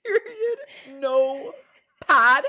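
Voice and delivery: laughing. Real, not acted. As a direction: repeat the joke while cracking up. Cracking up at the joke.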